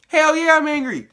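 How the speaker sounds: high, loud imitation of an angry man; mock angry yell. Luke admitting to Danny he is angry, mock angry shout. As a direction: fire back in hammed-up anger. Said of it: "you're angry".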